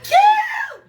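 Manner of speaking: high, loud excited shout; acted-out joyful scream; excited call. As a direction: yell the name out in triumph. Acting out future call to leader.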